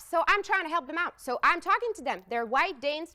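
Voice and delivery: ordinary delivery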